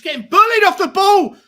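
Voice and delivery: loud, high angry yelling at the player; furious shout. Real, not acted. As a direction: yell at the screen in disbelief. Team bullied on the pitch.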